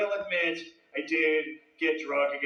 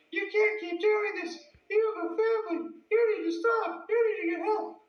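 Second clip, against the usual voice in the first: put-on high nagging girlfriend voice. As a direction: scold him in her high voice. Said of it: "just getting bitched at".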